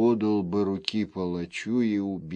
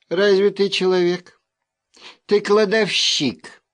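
higher, louder nagging wife; scolding wife; 8 semitones higher, 5 dB louder than usual. A narrator's reading. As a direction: needle him like a nagging wife.

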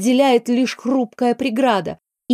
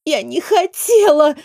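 high, tearful outburst; crying girl blaming the adults; tearful accusation; 6 semitones higher than usual. A narrator's reading. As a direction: sob out the blame, voice climbing higher. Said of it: "Девочка всхлипнула".

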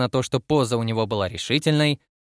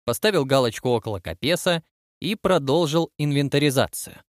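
usual speaking voice

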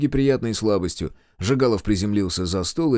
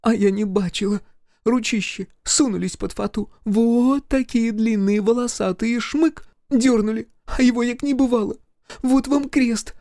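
village bride; 12 semitones higher than usual. Acted. Bride describing the hairy hands.

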